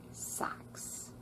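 slow hushed aside; whisper. A narrator's reading. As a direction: whisper it slowly.

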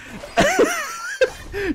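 high-pitched burst of laughter; real laughing fit. Real, not acted. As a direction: crack up at your own story. Cracks up at own anecdote.

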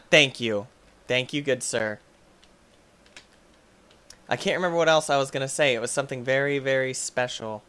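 ordinary talk

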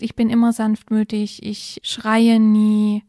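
hammed-up resolution with drawn-out final words; mock solemn vow. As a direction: stretch out "schreie nie" like a vow. Voices an exaggerated parental vow. (übertrieben feierlicher Vorsatz, gedehnt)

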